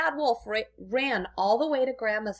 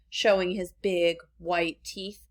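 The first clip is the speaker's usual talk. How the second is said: usual speaking voice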